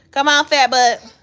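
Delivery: loud, high affectionate call. Real, not acted. Mom calling her chubby son out. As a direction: call the little one out with a loud tease.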